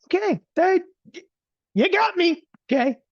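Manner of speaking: very high, loud, defeated squeak; husband's high, loud, mock-defeated surrender to his wife. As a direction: throw up your hands in a high voice. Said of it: "I was".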